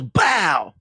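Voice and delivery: gunshot noise. Retold or imitated. Imitating a gunshot sound.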